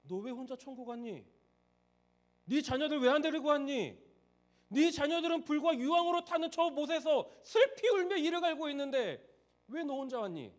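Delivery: loud, high, stern rebuke that builds to a cry; the Lord scolding a parent who came alone, voice rising in anger; thundering reproach from heaven; rebuking Lord. Retold or imitated. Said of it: "주님이 물어보지 않겠어요".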